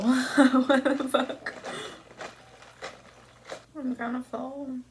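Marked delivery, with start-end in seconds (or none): 0.0-1.5 slow laughing outburst; laughing disbelief. Real, not acted. Laughing at surprise portion.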